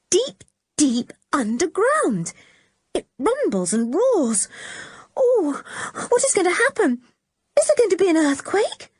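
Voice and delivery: put-on frightened girl alone on the hillside, growing panic; rising panic. A narrator's reading. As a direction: fear building to a high wail. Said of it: "Then she sat up, frightened".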